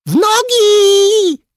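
very high, drawn-out yell; loud shout. A narrator's reading. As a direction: yell the alarm at full voice, stretching it. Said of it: "krzyknął tak głośno i przekonująco". (głośny, przekonujący krzyk na alarm)

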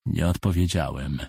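ordinary delivery, nothing marked

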